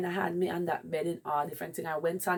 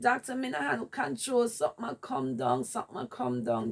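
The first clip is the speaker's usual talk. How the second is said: urgent plea; 6 semitones higher than usual. Retold or imitated.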